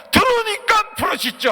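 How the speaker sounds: very high, loud cry; emphatic full-voice proclamation; preacher's shout. Real, not acted. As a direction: shout the answer triumphantly. Preacher shouts his answer.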